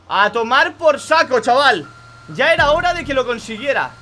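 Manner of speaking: loud, slow victory cry; triumphant shout. Real, not acted. Gamer celebrating finally drifting right.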